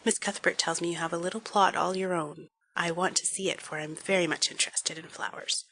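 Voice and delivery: gently reassuring. Acted. Mrs Allen consoling Anne.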